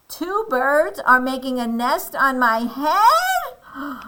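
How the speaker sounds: high, loud, slow question; appalled disbelief. Acted. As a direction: draw it out in shocked disbelief. Elephant appalled at nest news.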